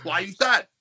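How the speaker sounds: blunt mock Polish accent. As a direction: quote him curtly with a Polish accent. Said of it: "just like that".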